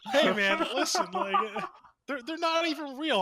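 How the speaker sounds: high, laughing mock coworker voice; laughing imitation. Mocking a coworker, high pitched and laughing. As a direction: play the flustered coworker through giggles. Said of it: "And he's like".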